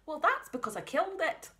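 a hint of a Scottish farm mum. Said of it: "the mum has got the Scottish voice".

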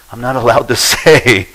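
sheepish laugh breaking into the line; half-laughing, playfully secretive; a coy chuckle mid-sentence; laughing coyly. Retold or imitated. Coy reply to the teachers.

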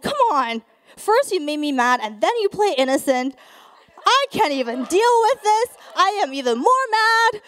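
loud, exasperated rant; mad. Retold or imitated. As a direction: re-enact a fed-up outburst, rising in pitch. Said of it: "I was so mad".

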